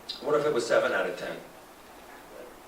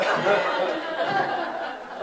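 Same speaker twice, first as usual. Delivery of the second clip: very high trailing laugh; high real laugh; 3 dB louder than usual. Real, not acted. To the audience about blue eyes, high real laughter.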